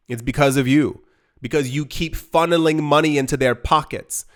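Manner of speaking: usual speaking voice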